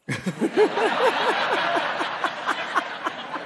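helpless laughter; big laugh. Real, not acted. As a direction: laugh out hard. Crowd laughing at Belmullet story.

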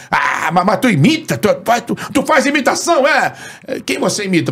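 high, stammering radio host impression; Antônio Carlos. Radio host questioning an imitator, high stammering impression. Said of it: "Antônio Carlos".

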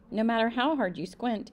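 ordinary delivery, nothing marked

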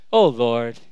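low, weary oath; offhand exasperation. Acted. Common saying in culture and society, offhand exasperation. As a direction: groan it low, as a stock phrase. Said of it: "I'm just saying it".

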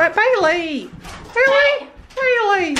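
high, loud call; calling the dog. Real, not acted.

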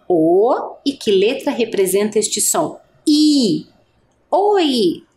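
teacher sounding out "O", "I", "Oi". Shown as a demonstration. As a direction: split and then join the vowels.

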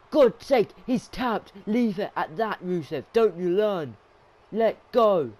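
indignant, high-pitched telling-off; exasperated scolding. Real, not acted. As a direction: yell at the wrestler to stop. Scolding wrestler who won't release.

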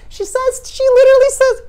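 can't believe she said that; astonished disbelief. Real, not acted. Amazed at spirit's bluntness.

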